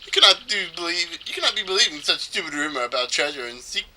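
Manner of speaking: lightly hammed-up scoffing; dismissive trader character. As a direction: scoff theatrically, ending on a high "no".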